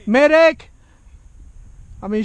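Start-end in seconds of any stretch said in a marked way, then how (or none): 0.0-0.7 loud call for a medic, repeated; man down shout. Real, not acted. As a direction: yell for help again, a bit less high. Shot, calling for medic again.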